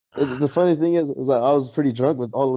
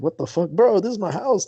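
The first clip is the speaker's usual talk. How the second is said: higher-pitched retold protest; exasperated host, voice climbing as it goes; mock outrage rising in pitch; indignant; 7 semitones higher than usual. Retells protesting chaos in own house.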